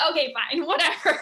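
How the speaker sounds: giggling, give in playfully; laughing at the sister's reply, giving in; laughing concession. Retold or imitated. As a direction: let it go with a laugh. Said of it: "it's like".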